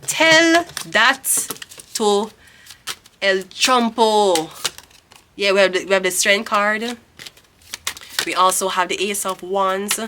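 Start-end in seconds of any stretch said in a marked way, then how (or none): 0.1-4.5 slow, mocking "El Trumpo", each word dragged out; slow teasing jab at Trump; mocking drawl. Real, not acted. Mock renaming of Trump.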